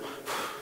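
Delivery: soft blown-out breath; sigh of relief. Shown as a demonstration. Preacher showing what God does when squeezed, relieved exhale. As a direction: exhale as pressure lifts. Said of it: "Dios te hace esto".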